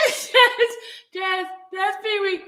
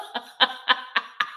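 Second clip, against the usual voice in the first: hiccup giggles; 7 semitones lower, 3 dB quieter than usual. Real, not acted. Breathless giggle after Jazz's line.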